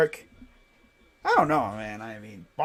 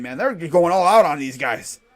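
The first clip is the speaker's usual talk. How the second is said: ordinary talk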